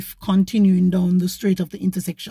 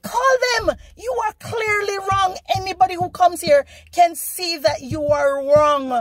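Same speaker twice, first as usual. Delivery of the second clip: indignant dare; 9 semitones higher than usual. Retold or imitated. Daring the driver to call.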